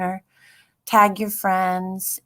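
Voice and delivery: ordinary talk, nothing marked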